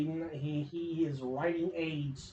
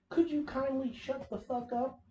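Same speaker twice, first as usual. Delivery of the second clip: exasperated; 6 semitones higher than usual. Acted. Exasperated rant at the character.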